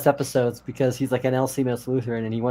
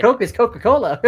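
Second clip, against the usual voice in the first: much higher and louder, exasperated; mock outrage; 10 semitones higher, 6 dB louder than usual. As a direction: spell it out like it's obvious. Mock indignant explanation.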